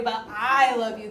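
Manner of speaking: "I" in "but I love you" leaned on hard; stress on I. Shown as a demonstration. Teacher contrasting who loves, weight on "I". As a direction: hit "I" to show who loves. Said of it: "emphasize more, like, who is doing the loving".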